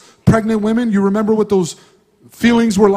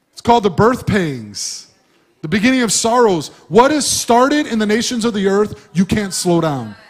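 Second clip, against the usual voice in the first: normal talking voice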